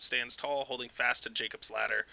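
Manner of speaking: ordinary talk, nothing marked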